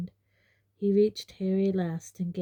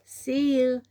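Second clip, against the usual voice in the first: loud, higher call; 6 semitones higher, 7 dB louder than usual. A narrator's reading. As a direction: call out a goodbye from the train. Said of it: "Harry called out".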